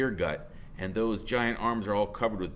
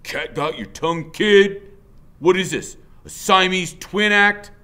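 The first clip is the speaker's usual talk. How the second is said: louder, higher taunting; bullying biker; 9 semitones higher, 11 dB louder than usual. Acted. Biker taunting boy after poking his chest, loud. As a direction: poke fun with every word. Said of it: "he says".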